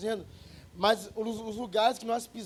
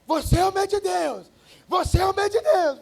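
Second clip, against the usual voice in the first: high, loud mock shout from across the store; imitated shouting man; 11 semitones higher, 10 dB louder, slower than usual. Preacher imitating a man yelling at him in the grocery store, loud shout. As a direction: holler it out like an excited stranger. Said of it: "o cara sai lá do fundo gritando".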